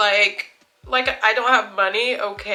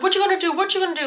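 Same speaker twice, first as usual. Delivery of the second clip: high-pitched imitated goading; mock taunt; 10 semitones higher, much faster than usual. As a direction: mimic an aggressor's taunt, pitched way up. Acted taunt while chasing her.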